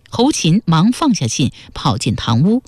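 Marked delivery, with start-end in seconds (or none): none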